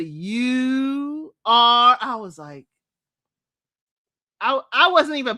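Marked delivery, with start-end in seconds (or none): 0.0-2.0 mock dramatic pause. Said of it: "It was a".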